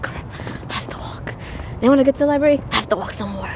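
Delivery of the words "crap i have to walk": whispered grumble. Real, not acted. Mom grumbles about cold walk.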